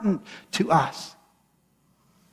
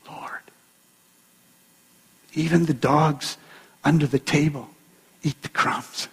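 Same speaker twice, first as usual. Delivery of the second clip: lower, whispered plea from a humble woman; 5 semitones lower than usual. Acted. Woman's humble reply to Jesus.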